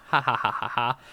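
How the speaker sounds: mocking ha-ha-ha of a villain; hammed-up evil gloating laugh. Villain gloats over captive Doctor.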